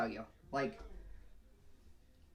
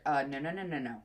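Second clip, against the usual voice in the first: firm no to dog; 5 dB louder than usual. Retold or imitated. Calling dog back from following.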